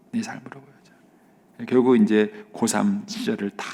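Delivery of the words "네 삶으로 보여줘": 네 삶으로 보여줘 even slower and far softer, almost gone; fading whispered echo. Retold or imitated. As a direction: let the echo die on a breath. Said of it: "그 말씀 중에 하나가 이거였어요".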